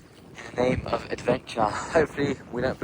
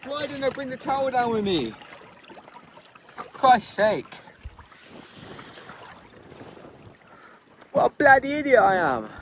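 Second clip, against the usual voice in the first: exasperated at his own mistake; high, frustrated scolding of himself; self-annoyed; 12 semitones higher than usual. Real, not acted. Forgot towel, cursing himself.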